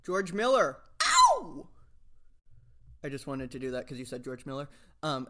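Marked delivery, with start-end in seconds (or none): 0.0-0.8 shouted name. Acted. 1.0-1.7 shrill high-pitched "Ow!" yelp. Acted. Yelps after shouting name.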